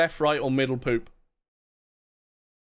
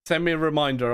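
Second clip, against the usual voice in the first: ordinary talk, nothing marked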